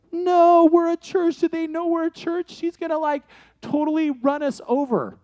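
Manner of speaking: high, loud mock panic; panicked protest. Church volunteers fearing the driver will run them over, mock panic. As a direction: blurt it out in exaggerated alarm.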